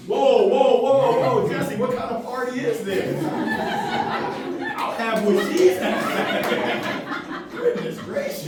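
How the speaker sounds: laughing loudly through the words; cracking up at the crowd's answer. Real, not acted. Speaker reacting to a surprising audience answer, laughing loudly.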